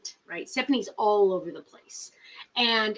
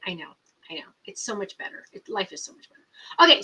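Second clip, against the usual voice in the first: usual speaking voice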